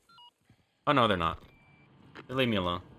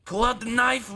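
urgent yell; 12 semitones higher, 3 dB louder, slower than usual. Real, not acted. Yelling at Leon urgently.

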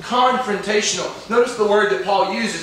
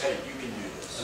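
gentle encouragement; 7 semitones lower, 13 dB quieter than usual. Acted. Father encouraging struggling child.

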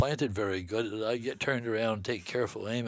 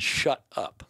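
retold shut up; 4 semitones higher, 4 dB louder than usual. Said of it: "He said".

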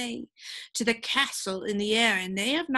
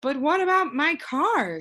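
put-on worried boy; 7 semitones higher, 4 dB louder than usual. Milo worried question.

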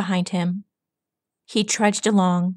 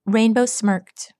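usual speaking voice

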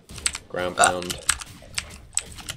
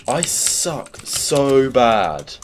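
slow, louder whining groan; drawn-out whine; 5 semitones higher, 7 dB louder, much slower than usual. Real, not acted. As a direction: stretch every word out in self-pity. Losing the game, self-mocking.

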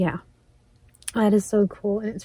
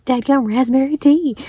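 loud thrilled exclamation; louder, higher happy outburst; excited; 4 semitones higher, 6 dB louder than usual. Real, not acted. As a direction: burst out in delight. Thrilled at the gift.

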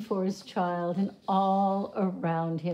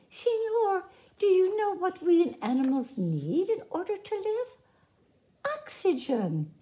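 much higher, small quizzing creature voice; little porcupines; 11 semitones higher than usual. A narrator's reading. Porcupines quiz the woodcutter, high and eager.

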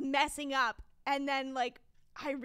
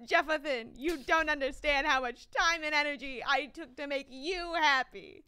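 mock scolding; 2 semitones higher, 5 dB louder than usual.

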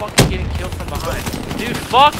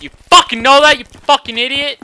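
raised, loud angry jab; angry insult; 4 semitones higher, 6 dB louder than usual. Real, not acted. Player cursing an opponent, angry. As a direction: lash out at the opponent, higher and louder.

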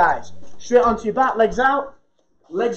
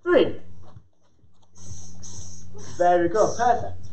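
counting out reps; slower than usual. Real, not acted. Coach counting reps in class.

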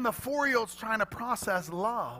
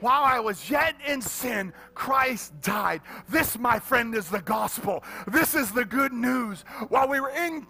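higher, louder preaching with music; full-force preaching; 6 semitones higher, 8 dB louder than usual. Acted. Preacher proclaiming salvation to the congregation at full force.

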